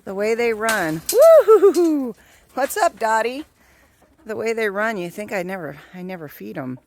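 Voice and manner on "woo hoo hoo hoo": loud high woo-hoo whoop. Real, not acted. Whooping at running chickens.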